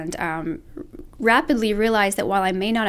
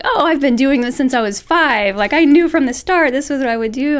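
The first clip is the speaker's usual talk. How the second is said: mock art-school classmate boasting, higher and louder; art school peers; 6 semitones higher, 8 dB louder than usual. Art student imitating boastful classmates who started at five, higher and louder. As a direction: brag like a lifelong art kid. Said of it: "people that I met in art school were like".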